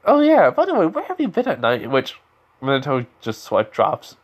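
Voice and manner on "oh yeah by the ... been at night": high, loud put-on woman's voice; Kushina's voice. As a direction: start high with a sudden pointed question. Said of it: "Kushina just technically says".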